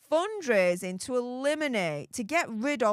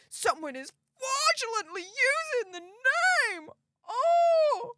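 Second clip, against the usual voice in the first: mock sobbing; 12 semitones higher, slower than usual. Mocking the fan's outrage.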